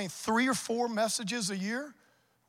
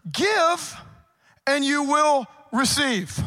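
preaching at full force; 2 semitones higher, 4 dB louder than usual. Acted. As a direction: call each verse out like a refrain. Preaching the verses loudly.

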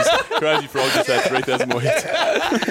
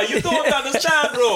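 put-on higher, slower laughing Caribbean accent; 11 semitones higher, slower than usual. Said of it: "Really great accent".

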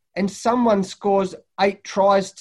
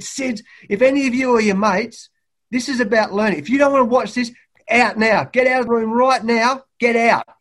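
loud, higher, furious order to leave the room; retold fury, loud and raised; angry coach yelling; 4 semitones higher, 5 dB louder than usual. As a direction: bark the players out like an enraged coach.

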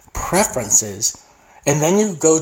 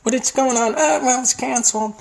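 mock confused voice; 10 semitones higher than usual. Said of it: "You just were like".

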